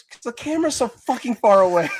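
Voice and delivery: high-pitched imitated yell; mock yelling. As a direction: call it out as if to someone far away. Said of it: "Why are you yelling?".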